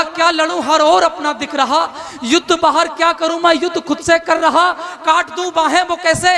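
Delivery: rising pained declamation; anguished poem recitation. Acted. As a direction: plead through the verse. Arjun's anguished verse.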